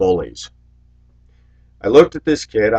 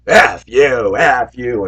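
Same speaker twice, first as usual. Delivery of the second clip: mock kid's loud curse, louder; retold curse; 4 semitones higher, 5 dB louder, much slower than usual. Kid cursing back at the bus driver, mock loud retort. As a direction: fling it back like a defiant kid.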